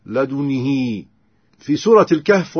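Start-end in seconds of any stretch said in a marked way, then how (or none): 0.0-1.0 لدنه as "ladunihee"; i on the ن, long ee on the ه of لدنه; kasra with lengthened ha. Shown as a demonstration. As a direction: draw out the final "hee" of لدنه. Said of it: "وصلتها".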